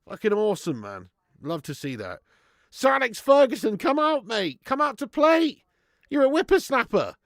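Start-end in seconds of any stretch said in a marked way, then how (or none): 2.7-7.1 higher, louder playful goading; mock taunting. Streamer jokingly calling out Sir Alex, mock taunting. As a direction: tease him like a cheeky mate.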